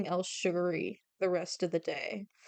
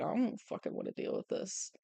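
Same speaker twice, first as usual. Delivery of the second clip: whisper; 8 dB quieter than usual. Retold or imitated. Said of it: "He's like".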